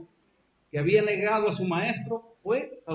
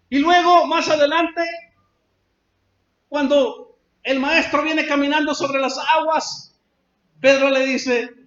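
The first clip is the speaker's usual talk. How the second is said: loud, high sermon delivery; fervent preacher; preaching at full force; 7 semitones higher, 7 dB louder than usual. Acted. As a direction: drive the story home from the pulpit.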